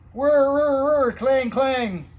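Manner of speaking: high, loud imitated whir and clang; siren and bell noises. A narrator's reading. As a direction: sound like a fire engine racing off. Fire engines race out.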